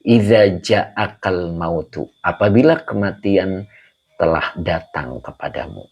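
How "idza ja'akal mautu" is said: Idza ja'akal mautu read slowly and carefully, each syllable clear; pelan, dibaca seperti mengaji; slow Arabic recitation. Shown as a demonstration. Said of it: "makanya di sini".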